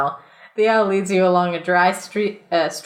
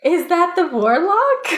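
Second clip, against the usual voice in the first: laughing question; 9 semitones higher than usual. Acted. Voicing player character, laughing.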